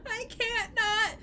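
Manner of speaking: high, slow hammed-up crying wail; high mock-weepy whine, slowing down. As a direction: fake a tearful sob of sympathy. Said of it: "It's like".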